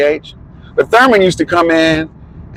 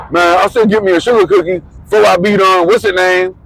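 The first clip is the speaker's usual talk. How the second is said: imitating Thurman; 6 semitones higher than usual. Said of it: "And he goes".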